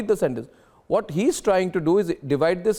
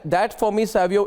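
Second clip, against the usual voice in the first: ordinary talk, nothing marked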